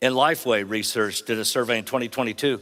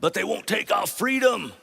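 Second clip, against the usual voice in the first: high, raised battle cry; mock Braveheart yell; 10 semitones higher than usual. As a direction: belt it out like a rallying cry. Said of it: "when you hear Mel Gibson yell".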